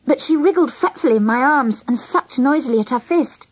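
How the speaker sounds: ordinary delivery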